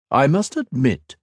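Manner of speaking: the gloating emperor speaking down to a beaten foe; villain emperor voice. A narrator's reading. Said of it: "Shinzan added".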